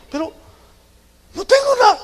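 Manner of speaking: very high, squeaky mock wail; hammed-up tearful denial; imitated crying wife. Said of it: "de pronto llegaba y estaba llorando".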